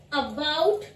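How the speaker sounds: 'about' opening on a short schwa 'uh'; correct pronunciation. Shown as a demonstration. Teacher models the schwa sound, careful 'about'. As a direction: say uh-BOUT for 'about'. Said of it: "इसमें है about".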